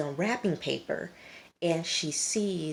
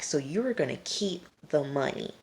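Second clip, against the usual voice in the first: usual speaking voice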